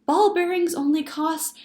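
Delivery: put-on high, loud little-girl complaint; girl interrupting. A narrator's reading. Sally cutting in about her broken skates, loud interrupting child. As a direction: butt in loudly as an aggrieved child. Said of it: "When Sally interrupted".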